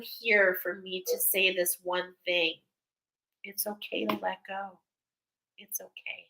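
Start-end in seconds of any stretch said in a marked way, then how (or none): 3.4-6.3 soft reassurance. Acted. Comforting viewers.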